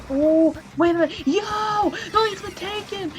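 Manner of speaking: high, shouting play-by-play; excited shout. Real, not acted. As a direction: yell it like the lead just flipped. Caster reacts to a stolen lead.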